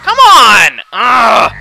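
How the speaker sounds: loud barked come on and groan; frustrated shout. Real, not acted. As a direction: shout at the game in annoyance. Frustrated gamer yelling at screen.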